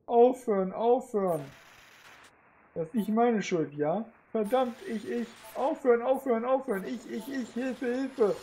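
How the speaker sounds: hoch und gehetzt, flehend; in Panik um Hilfe flehen; panische Hilferufe. Acted. Android bricht panisch zusammen. (panisches Flehen)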